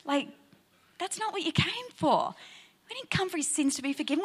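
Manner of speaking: deflated, as if cheated of a miracle; disappointed disbelief. Pretend. Pastor voicing the friends' letdown, soft and incredulous. Mock letdown at Jesus' answer.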